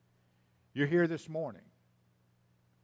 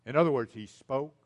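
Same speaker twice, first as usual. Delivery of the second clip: normal talking voice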